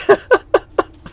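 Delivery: high, loud burst of laughter. Real, not acted. Laughing off a listener's complaint about the accent, loud laugh.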